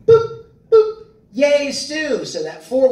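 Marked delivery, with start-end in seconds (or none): 0.1-1.1 high, short electronic boops; imitated beeps. Shown as a demonstration. Showing the three beeps before a recorded line, high imitated beeps. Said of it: "So it would be boop". 1.3-2.2 high, gleeful little cheer; character cheer demo. Shown as a demonstration. Showing where the line starts after beeps, high cheer. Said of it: "where you start talking".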